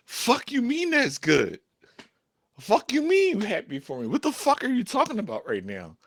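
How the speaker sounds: high-pitched, indignant mock outrage; exasperated mad. Boyfriend retorting to girlfriend's encouragement, high and exasperated. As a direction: fire back in high, incredulous disbelief. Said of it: "it used to make me mad".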